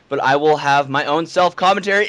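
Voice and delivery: ordinary talk, nothing marked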